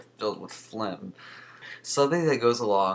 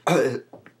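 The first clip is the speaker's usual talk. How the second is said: hacking cough; real sick cough; chesty cough; 5 semitones higher, 6 dB louder than usual. Real, not acted. Sick with pneumonia.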